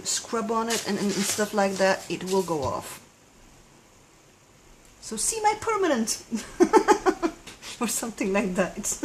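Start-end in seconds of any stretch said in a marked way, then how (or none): 5.0-9.1 laughing. Real, not acted. Laughing at own claim.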